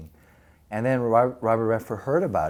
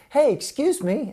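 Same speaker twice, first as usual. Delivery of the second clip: mock polite call; 12 semitones higher than usual. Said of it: "they go".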